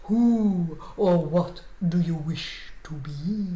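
low, slow, chanting lizard voice; mystical lizard. A narrator's reading. Magic lizard answers Alexander's call, mystical and slow. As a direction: intone it deep and drawn out.

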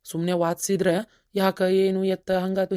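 normal talking voice

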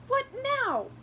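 very high and loud bird snap; high sharp bird voice snapping; wren snapping. A narrator's reading. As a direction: snap at her, fed up. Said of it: "the wren snapped".

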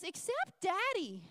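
put-on a child's simple, slow reveal. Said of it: "He goes".

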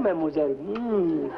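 hammed-up fake French accent, flirting; mock French charmer with a bad accent. [name] poses as Frenchman.

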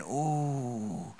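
soft low groan of disgust; a quiet queasy ooh; pained ooh. Retold or imitated. Guest biting into a strange dish, soft disgusted ooh. Reaction on crunching into food.

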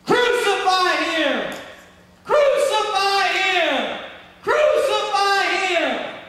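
loud, high, drawn-out chant; crowd shouting. Acted. Preacher acts the crowd condemning Jesus, shouting. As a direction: bellow it slowly like an angry mob. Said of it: "All these people that were shouting".

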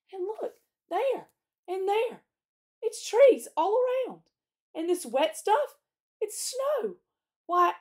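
high, loud exclamations of discovery; amazed excitement. A narrator's reading. Peter shows the others trees and snow, amazed. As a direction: point out each wonder, voice leaping up.

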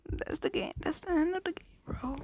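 breathy stunned whisper. Real, not acted. Gamer stunned by the ending, whispering. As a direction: whisper it in awe. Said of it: "whispered reaction".

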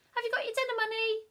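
brisk morning question; Mum asking. Acted.

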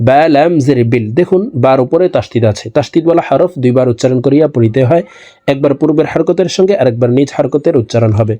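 ordinary talk

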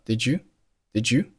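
"Did you" merged into "didju"; assimilated linking. Shown as a demonstration. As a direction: blend d and y into a j sound in "Did you". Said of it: "did和you发生了同化连读的现象".

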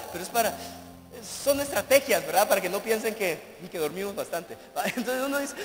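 ordinary delivery

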